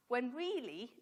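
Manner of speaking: normal talking voice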